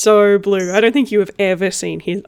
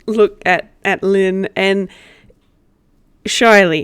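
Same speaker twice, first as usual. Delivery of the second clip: normal talking voice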